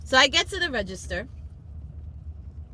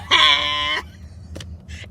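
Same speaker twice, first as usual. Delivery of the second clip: loud, high laughing shriek; re-enacted laughing burst; 6 semitones higher, 6 dB louder than usual. Customer reliving a laugh with the cashier, loud cackle. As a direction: burst out with a big retold cackle. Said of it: "we were both laughing in the counter".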